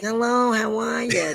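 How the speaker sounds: a hint of a whiny Horseshack greeting; whiny Brooklyn greeting. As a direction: lightly whine the hello like a Brooklyn guy. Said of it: "They're kinda whining like Arnold Horseshack".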